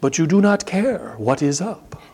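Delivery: measured, careful imitation of a Kenyan accent; slightly high, deliberate mock-Kenyan accent; awful Kenyan accent. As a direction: do an amateur Kenyan accent, each word set apart. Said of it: "you'll pardon the awful accent".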